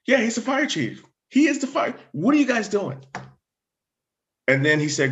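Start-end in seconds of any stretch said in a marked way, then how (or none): 0.1-3.1 much higher, exasperated disbelief; incredulous scolding. Retold or imitated. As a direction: voice the lieutenant chewing out his officers, pitch shooting up. Lieutenant scolding the cops.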